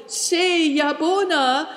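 high, drawn-out repeated call. A narrator's reading. Girl calling until the riders are far away, calling out. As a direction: keep calling after them, slow and high. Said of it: "She kept calling".

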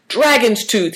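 high-pitched exclamation; crying out. A narrator's reading. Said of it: "he cried".

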